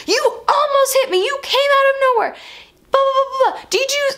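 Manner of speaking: high-pitched, scolding mock yell; imitated yelling. Irate driver scolding her over the yellow line, mock yelling. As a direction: scold in a hammed-up high rant. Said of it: "he's yelling".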